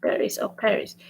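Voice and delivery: "Paris or Paris", both with a sounded s; both takes of "Paris" soft, ending in s; English pronunciation. Shown as a demonstration. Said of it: "That's how we pronounce it".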